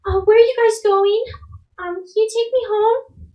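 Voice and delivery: put-on high-pitched, questioning little girl; small child's high voice. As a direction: ask like a lost little kid. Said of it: "the little girl kinda".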